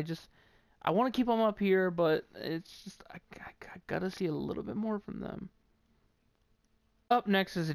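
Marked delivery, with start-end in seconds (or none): 2.4-5.5 hushed, quick whisper. Real, not acted. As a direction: trail off into a whisper. Drops to a whisper.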